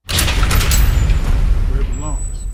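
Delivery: slow, loud, drawn-out put-down; mocking dismissal. Preacher dismissing the doctrine to the congregation, loud and slow. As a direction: stretch it out loud like tossing trash.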